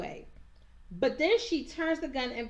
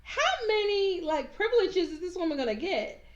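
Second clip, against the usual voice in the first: exasperated outburst; 8 semitones higher than usual. Real, not acted. Ranting about Serena's privileges.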